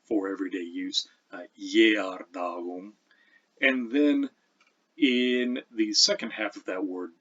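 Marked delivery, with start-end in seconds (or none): 1.6-2.9 Jerdalum starting with a Y sound; Old English Jerdalum with a gliding Y onset; palatalized G as Y. Shown as a demonstration. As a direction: say Jerdalum slowly, initial G as Y. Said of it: "almost replace this with a Y".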